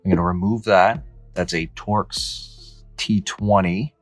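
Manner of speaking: normal talking voice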